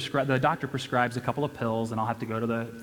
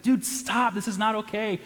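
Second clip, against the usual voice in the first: mock yell; 8 semitones higher than usual. Retelling yelling at reckless friend.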